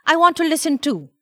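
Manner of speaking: put-on high, insistent little boy; eager child. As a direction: butt in, wanting a turn. Eager boy butting in on call.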